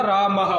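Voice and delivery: रामः said with a short breathy h after the final a; visarga pronunciation. Shown as a demonstration. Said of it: "इसकी क्या sound बनेगा".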